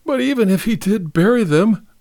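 high-pitched tearful objection; sniffling. A narrator's reading. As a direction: argue with a weepy sniffle. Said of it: "Martha sniffled".

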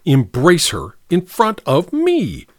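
drawn-out jealous sneer; contemptuous. A narrator's reading. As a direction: goad them bitterly, in your head. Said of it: "thought Nicky contemptuously".

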